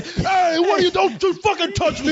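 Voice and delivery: high, sharp angry outburst; angry snapping man. Retold or imitated. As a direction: snap at her indignantly. Voicing the angry man in theater.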